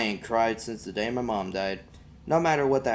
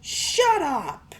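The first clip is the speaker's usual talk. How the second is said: put-on high, loud boy's yell; boy yelling at his tormentor; angry shout; 5 dB louder than usual. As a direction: snap back at the bully. Boy yells at bully.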